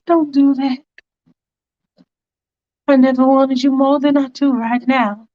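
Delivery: Faith insisting. Retold or imitated. Faith tries to seduce him.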